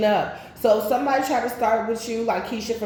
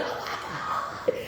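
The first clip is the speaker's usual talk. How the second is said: breathless whisper; 8 dB quieter, much slower than usual. Retold or imitated.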